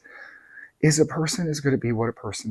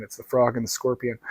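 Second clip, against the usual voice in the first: ordinary delivery, nothing marked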